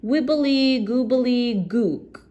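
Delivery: slow, high incantation; magic word. Acted. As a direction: chant the magic word slowly. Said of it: "a magic word known only to goblins".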